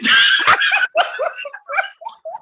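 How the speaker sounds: very high, loud shrieking laugh. Real, not acted. Caught by the coworker, shrieking squealing laugh.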